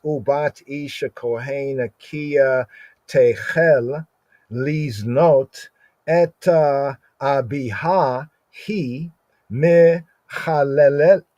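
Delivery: slow, deliberate Hebrew recitation; slow scripture reading. Shown as a demonstration. Teacher reading Hebrew Torah to class, slow and measured. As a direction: chant the verse out word by word. Reads the Hebrew verse aloud.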